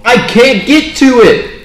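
frustrated yell. Real, not acted. Frustrated player stuck on task.

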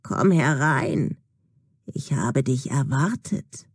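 put-on low, slow old crone voice; creepy old woman welcoming the lost girl, low and slow; toothless old woman. A narrator's reading. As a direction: draw the words out low like a toothless witch. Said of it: "sagt mit ihrem fast zahnlosen Mund".

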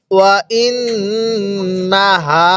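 ওয়া ইন্নাহা slowly, the doubled ন hummed through the nose; with ghunnah. Shown as a demonstration. Teacher demonstrating ghunnah to students, slow nasal recitation. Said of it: "এরকম ভাবে পড়ব".

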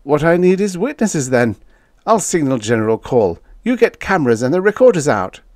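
high, urgent character voice; rally the crew in a rush of excitement; excited spaceman giving orders. Acted.

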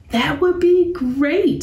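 put-on high, delighted little girl; a cub thrilled by the tour idea; Sister cub voice. A narrator's reading. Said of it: "said Sister".

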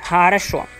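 normal talking voice

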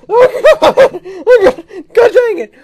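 loud, high cries of alarm; yelling. Real, not acted. Player crying out at the game, alarmed. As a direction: cry out as you fall.

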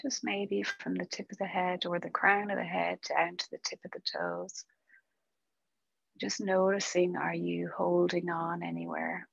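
calm, unhurried meditation guide with long pauses; guided meditation. Acted. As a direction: ease them gently into stillness, softening as it goes.

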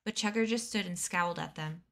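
ordinary delivery, nothing marked

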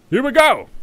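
high, loud burst of excitement; excited yell. Real, not acted. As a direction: shout it out as the ship launches. Excited launch of ship.

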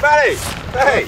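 excited long-distance call; shouted greeting. Real, not acted. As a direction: holler back to a mate. Guide calls back in greeting.

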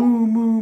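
loud mock moos; cow mooing. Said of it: "the cow stands in the field".

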